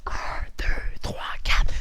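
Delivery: hushed, voiceless counting; whispered count. Shown as a demonstration. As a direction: whisper each number distinctly. Same count repeated whispered. (compte chuchoté)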